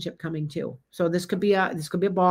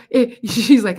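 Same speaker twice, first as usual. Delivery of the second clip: normal talking voice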